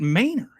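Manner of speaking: "Manor" echoed as "MAY-ner"; Texas pronunciation. Retold or imitated. Baffled reply to friends, "Manor" said as "MAY-ner". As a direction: throw back "Manor" with a long a, "main-er". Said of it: "Who pronounces it".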